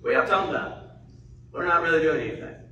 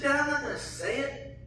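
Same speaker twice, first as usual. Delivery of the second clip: put-on higher, childlike refusal; embarrassed little girl; 10 semitones higher, 5 dB quieter than usual. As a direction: play the shy daughter who won't say it. Daughter voiced, embarrassed.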